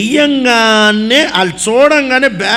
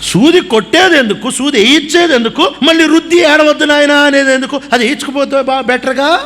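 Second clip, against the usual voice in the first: indignant mock complaint; 6 semitones higher than usual. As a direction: protest like a wronged child. (కోపంగా ప్రశ్నించే స్వరం)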